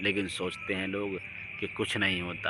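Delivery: normal talking voice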